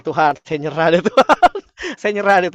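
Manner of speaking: loud retold cry of giving up, breaking into laughter; laughing mock surrender. Preacher retells surrendering to God, laughing mock surrender. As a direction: give up to God, amused at yourself.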